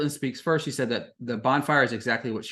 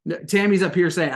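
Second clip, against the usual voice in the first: ordinary delivery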